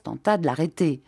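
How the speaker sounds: ordinary delivery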